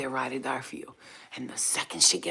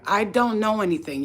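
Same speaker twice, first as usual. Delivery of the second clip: mock denial; 4 semitones higher, 6 dB louder than usual. Pretends to face cops.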